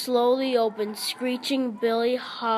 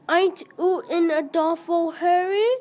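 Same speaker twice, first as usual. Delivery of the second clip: much higher put-on girlish voice; shrill girlish voice; 7 semitones higher than usual. Billy calling after the fleeing boys in a girlish voice. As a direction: tease the boys as a silly girl. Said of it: "shouted Billy".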